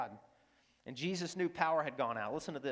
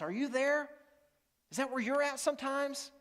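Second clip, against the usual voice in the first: high, pleading question; earnest appeal; 8 semitones higher than usual. Real, not acted. Pastor asking the congregation directly, raised and pleading. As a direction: reach out to them with a lifted voice.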